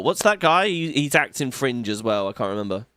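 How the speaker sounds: ordinary talk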